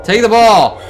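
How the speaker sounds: high, loud demanding shout; frustrated yell. Real, not acted. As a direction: bark it at the ghost. Yelling at ghost girl.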